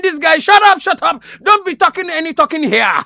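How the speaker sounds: high-pitched mock angry telling-off; Donald Trump, angry. Comic newsman quoting president to reporter, mock angry outburst. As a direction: act out an irate order to shut up. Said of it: "President Donald Pompey Pompey Trump is getting angry".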